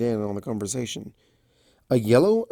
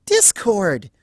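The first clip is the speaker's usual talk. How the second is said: high, loud scolding cry; shocked scold; 9 semitones higher, 6 dB louder than usual. Acted. Fluttershy scolding Discord, loud and high. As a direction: rebuke him in shock.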